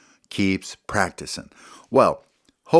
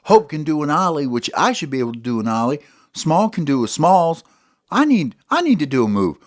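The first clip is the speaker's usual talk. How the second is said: Ollie's voice; 7 semitones higher than usual. Acted. Ollie complaining he has no move of his own. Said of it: "So Ollie says".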